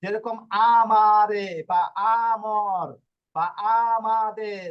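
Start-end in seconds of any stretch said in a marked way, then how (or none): 0.7-2.9 syllable-by-syllable আমারে, আমর for learners; drawn-out pronunciation demo. Shown as a demonstration. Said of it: "স্বরে আ এর মতো উচ্চারণ করবে". 3.8-4.6 teacher sounding out আমাদের vowel by vowel; drawn-out pronunciation demo. Shown as a demonstration. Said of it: "স্বরে আ এর মতো উচ্চারণ করবে".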